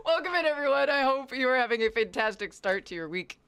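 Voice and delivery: high, loud, laughing through the greeting. Real, not acted. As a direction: restart the welcome while still laughing. Redoing the greeting while laughing.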